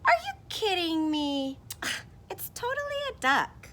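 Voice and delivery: high, loud disbelieving character voice with a sigh; incredulous character. Acted. Teacher voicing the duck-seer arguing back, incredulous. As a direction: scoff at the very idea. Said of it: "Now the other person says".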